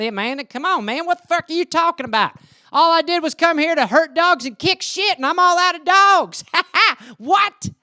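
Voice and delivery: hammed-up Southern accent, high-pitched. Shown as a demonstration. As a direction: holler like an outraged American Southerner. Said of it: "like, the South".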